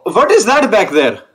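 normal talking voice

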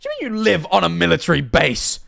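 loud, high-pitched disbelief; incredulous outburst. Real, not acted. Streamer baffled by living on a military base, incredulous. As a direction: question it in rising, outraged amazement.

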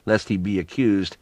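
ordinary delivery

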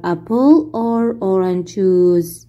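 drawn-out question for the class; slow it down word by word; very slow model reading. Shown as a demonstration. Teacher as flight attendant, slow model question. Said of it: "واحد بيكون هو المضيف للطيران".

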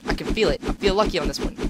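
usual speaking voice

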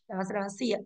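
ordinary delivery

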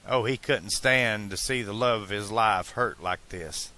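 ordinary delivery, nothing marked

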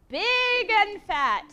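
"big and fat" very slow, high and loud; emphatic phrasing. Shown as a demonstration. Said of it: "I also saw you do this".

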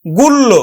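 গুল্ল with the m dropped; mistaken pronunciation. Shown as a demonstration. Teacher repeating the wrong form before correcting it, গুল্ল with long l. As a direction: double the l in গুল্ল, no m sound. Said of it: "কিন্তু আসলে উচ্চারণ হবে".

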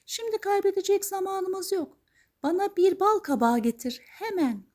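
very high, urgent fairy voice; high-pitched magical lady, brisk and commanding; fairy godmother giving orders. Acted.

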